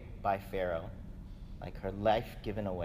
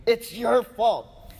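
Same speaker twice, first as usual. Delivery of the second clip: much higher, louder, slow accusing cry; Sarah blaming Abram; 11 semitones higher, 11 dB louder, much slower than usual. Retold or imitated. Sarah turns on Abram, shrill and loud with blame. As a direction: hurl the blame at him. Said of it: "she turns to Abram".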